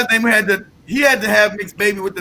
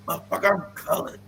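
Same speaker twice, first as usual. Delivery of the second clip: mock irate retort; 2 semitones lower, 7 dB quieter than usual. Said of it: "They got irate".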